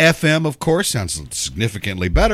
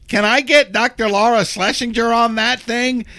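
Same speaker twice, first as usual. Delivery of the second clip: raised, put-on voice for Lisa asking; imitating Lisa's question; 11 semitones higher than usual. Host mimics Lisa asking about Doctor Laura, raised put-on voice. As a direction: mimic her eager request, pitched up. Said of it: "she goes".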